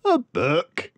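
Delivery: a lightly voiced scoffing dad; scornful father. A narrator's reading. Father scoffing at his daughter's request, lightly voiced.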